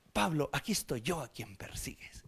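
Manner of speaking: a solemn whispered Bible line; whispered proper version. Acted. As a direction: whisper it like formal scripture. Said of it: "Entonces pon".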